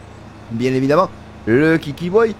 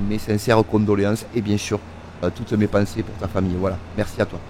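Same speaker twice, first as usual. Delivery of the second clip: hushed, sincere condolences, voice dropping softer; 7 semitones lower, 5 dB quieter than usual. Real, not acted. Offering condolences to his friend Draghi, hushed and sincere. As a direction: speak gently to a friend in mourning.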